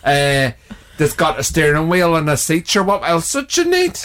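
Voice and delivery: ordinary talk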